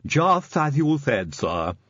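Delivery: slow, odd servant voice; Igor. Acted. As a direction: answer the master slowly, in character. Igor answers his master.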